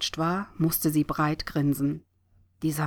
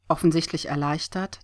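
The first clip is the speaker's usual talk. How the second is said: ordinary delivery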